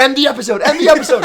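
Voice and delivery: high, loud playful demand; mock-frantic yelling. Co-host trying to cut the show short, mock frantic.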